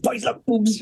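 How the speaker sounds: mock-excited "get a load of this"; showing-off kid. Boy showing his mates a silly trick, mock excited.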